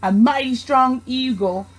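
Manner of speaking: plain reading voice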